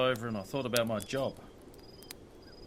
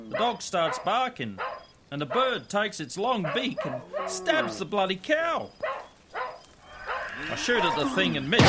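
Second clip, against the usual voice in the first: tense action narration; 5 semitones higher than usual. A narrator's reading. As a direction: carry the listener through the attack. Bird attacks the herd.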